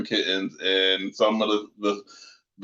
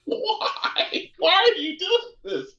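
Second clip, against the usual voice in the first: laughing why; slower than usual. Retold or imitated. Mock pleading with the GM.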